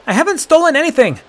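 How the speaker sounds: high, loud and fast protest; insisting. A narrator's reading. Boy denying theft to the guards, loud and high. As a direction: refuse the accusation hard. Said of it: "the boy insisted".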